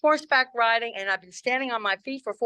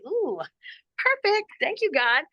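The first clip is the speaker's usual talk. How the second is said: high, delighted little coo; delighted discovery; 7 semitones higher than usual. Retold or imitated. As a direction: thank heaven for a dreamy find, pitched up. Retells spotting a handsome man.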